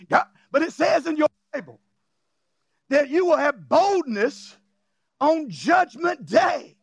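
=rousing pulpit delivery; preaching. Real, not acted. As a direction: punch the key phrases for the congregation. Preaches at full force.

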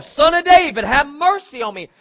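high, loud plea; insistent shout. Acted. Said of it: "he shouted even louder".

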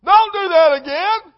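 high, loud mock scolding from the stands; parent yelling. Parent at the game yelling at their child, mock scolding shout. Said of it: "the parents are going".

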